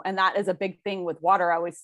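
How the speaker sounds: ordinary delivery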